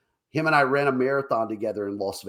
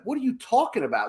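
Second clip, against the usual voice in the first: high-pitched protest of innocence; mock indignant denial; 9 semitones higher than usual. Boss denies it to angry girl, mock indignant. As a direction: act out an incredulous comeback.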